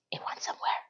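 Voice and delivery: a secret whisper to the audience; whispered aside. Real, not acted. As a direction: lean in and whisper it like gossip. Knowing aside to viewers.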